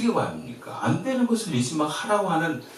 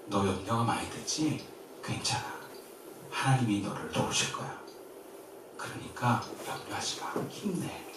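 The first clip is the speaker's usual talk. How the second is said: tender reassurance; 3 semitones lower, 8 dB quieter than usual. Acted. Jesus voiced as comforting.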